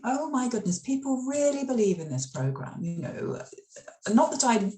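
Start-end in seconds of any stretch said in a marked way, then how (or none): 0.0-2.8 higher-pitched, mildly amazed inner voice; mock-astonished thought, voiced higher; retold astonishment. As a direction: act out a dawning realisation, pitched up. Retells her astonished realisation.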